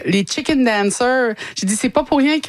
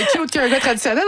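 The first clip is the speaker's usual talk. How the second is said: laughing; 6 semitones higher than usual. Real, not acted. Laughing about traditional guys.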